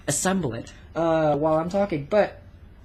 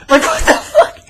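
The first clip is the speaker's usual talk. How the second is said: laughing; 12 semitones higher, 11 dB louder than usual. Real, not acted. Laughing at the cameraman's question.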